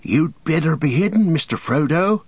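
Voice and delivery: a touch of fear as he warns; anxious. A narrator's reading. Sam anxiously urges Frodo to hide. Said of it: "said Sam anxiously".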